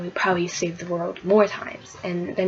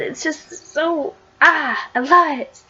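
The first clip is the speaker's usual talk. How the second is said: higher, drawn-out sigh of love; swooning fan; 8 semitones higher, 5 dB louder, slower than usual. Real, not acted. Fan telling viewers how much she loves it, swooning. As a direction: melt over the story.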